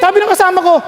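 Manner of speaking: normal talking voice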